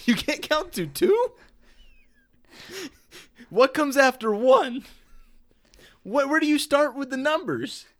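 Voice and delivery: high, slow, mocking disbelief; mocking incredulous. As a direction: talk down to a drunk passenger. Mocking driver scene.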